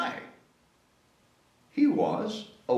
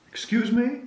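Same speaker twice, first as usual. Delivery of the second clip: high indignant squeak for the big bear; 9 semitones higher, 3 dB louder than usual. Acted. Big bear voicing a haughty high excuse me. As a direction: put out and offended, pitched way up.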